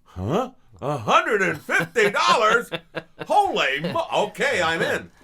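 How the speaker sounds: high, loud laughing disbelief; amazed. Retold or imitated. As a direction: react to the money in shock. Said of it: "And I went".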